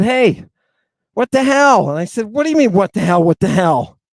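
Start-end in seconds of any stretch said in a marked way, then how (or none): 0.0-1.9 loud, high angry demand; angry confrontation. Retold or imitated. Nama confronts him angrily. 2.3-4.0 raised voice, defensive comeback; loud defensive retort. Retold or imitated. Defensive reply to Nama.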